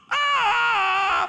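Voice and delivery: very high loud yelp; mock startled yelp. Keeper tossing cub in water, mock yelp. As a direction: yelp as it splashes. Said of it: "the other keeper just goes".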